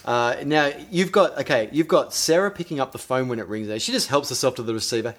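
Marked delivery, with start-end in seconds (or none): none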